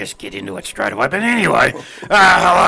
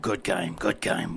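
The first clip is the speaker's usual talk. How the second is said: quiet; 6 semitones lower, 10 dB quieter than usual. Real, not acted. Quiet repeated praise.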